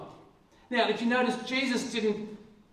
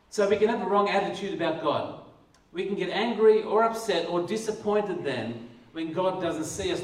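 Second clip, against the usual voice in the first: ordinary talk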